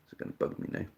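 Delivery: breathy whisper to himself; a private whispered worry. Real, not acted. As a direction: whisper it, almost unheard. Muttering to himself.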